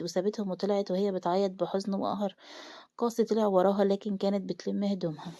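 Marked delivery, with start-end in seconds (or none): none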